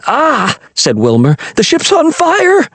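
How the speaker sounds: high alarmed yelp; boy shrieking that the ship burns; panicked cry. A narrator's reading. Wilmer panics at the light.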